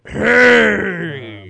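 loud, high cry of hey; Fonz shout. Acted.

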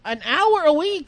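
high, slow disbelief; drawn-out, baffled question; incredulous. Real, not acted. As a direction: repeat it back in astonishment. Disbelief at the small figure.

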